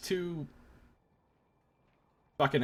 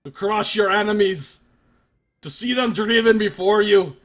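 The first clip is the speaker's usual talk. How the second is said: loud, higher action-hero declaration; Arnold Schwarzenegger; 5 semitones higher, 9 dB louder than usual. Pretend. Gamer tries a famous Arnold speech in his voice. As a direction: boom it like a barbarian king. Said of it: "I can't sound like Arnold Schwarzenegger".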